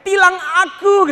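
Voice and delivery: high, loud, slow swooning cry; mock fangirl yell. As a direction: yell it like a fan at a handsome cop. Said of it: "antum akan teriak". (teriakan fans yang dibuat-buat)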